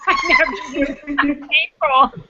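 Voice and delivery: laughing. Real, not acted. Laughing while recalling arthritis pain.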